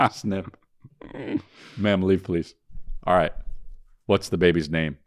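normal talking voice

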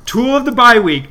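loud, high show-host announcement; segment announcer. Acted. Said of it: "announcing the segment".

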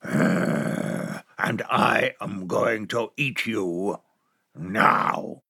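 low, hushed, creeping wolf; big bad wolf cornering the lamb; menacing wolf. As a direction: breathe it low like a wolf closing in.

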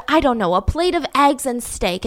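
ordinary talk

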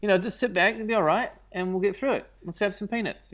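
put-on Australian accent, easygoing reassurance. Actor calming a nervous lady on a plane, Australian accent. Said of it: "slipped into my old Australian accent".

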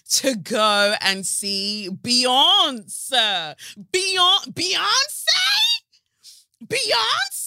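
high, loud, drawn-out cry of the star's name; hammed-up excitement. Host telling listeners she saw [name], hammed-up excited shriek. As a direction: belt out the name like a screaming fan.

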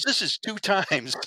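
high, slow, drawn-out disbelief; exasperated partner. Retold or imitated. Shot-at partner telling the officer he is done, in a high slow voice. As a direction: stretch it out, fed up and high. Partner quitting after second shooting.

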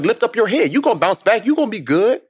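high, insistent pep talk, imitating mom; encouraging mother. Mother rallying her broken-down son, high and encouraging. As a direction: push him back up in a high motherly voice.

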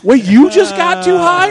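loud, very high, slow disbelief; incredulous. Retold or imitated. High guy questioning his improv partner, incredulous. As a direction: blurt it out, stunned.

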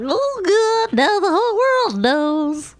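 high, loud gushing celebrity; hammed-up shallow celebrity; dumb celebrity. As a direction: ham up a dumb gushing star. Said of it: "they'll be like".

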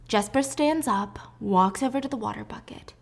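ordinary talk

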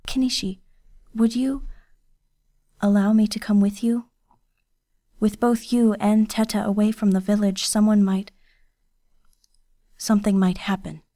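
put-on servant girl worried about her missing guardian; Kiyose, worried girl.

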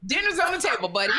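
high laughing imitation of a dad; calling kids to dinner. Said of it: "You said".

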